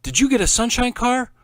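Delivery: high, loud eager asking; can't hide the excitement; excited question. A narrator's reading. Boy eagerly asking his friend about the car.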